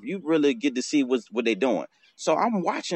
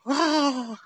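high, airy hawk whistle; hawk sound; 12 semitones higher than usual. Shown as a demonstration. Showing how the hawk goes, high airy whistle. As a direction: imitate the hawk's drawn-out cry. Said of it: "So the hawk go like this".

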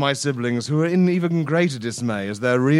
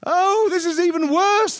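much higher, slower hammed-up wail; mock scream. A narrator's reading. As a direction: wail in mock despair like tiny biscuits. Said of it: "my siblings screamed".

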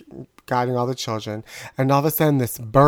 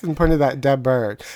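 usual speaking voice

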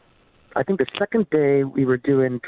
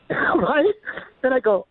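ordinary talk, nothing marked

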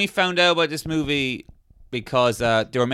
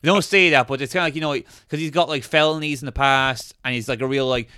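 ordinary talk

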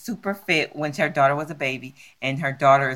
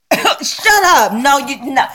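high, louder shut up in disbelief; can't believe what she just heard; real disbelief; 7 semitones higher, 4 dB louder, slower than usual. Real, not acted. Friend hears absurd bank story.

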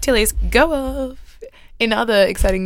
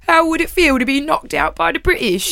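higher-pitched mock Pommy accent; obnoxious British accent; 6 semitones higher than usual. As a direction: imitate an obnoxious little British reporter. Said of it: "in his obnoxious little Pommy accent".

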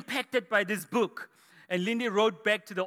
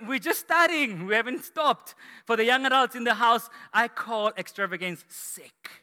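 ordinary delivery

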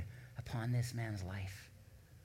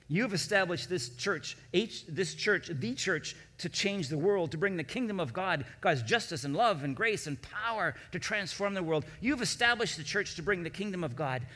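higher and louder, building to a ringing proclamation; preaching at full force; 5 semitones higher, 5 dB louder than usual. Real, not acted. Pastor to congregation, proclaiming God's faithfulness, building preaching. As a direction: preach it with growing fervor, climbing each line.

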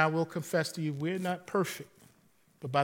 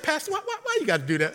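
much higher pitched, playful disbelief; mock exasperated question; 11 semitones higher, 4 dB louder, much faster than usual. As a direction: tease him for the stunt. Retold scolding of pastor.

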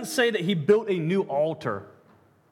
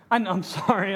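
usual speaking voice

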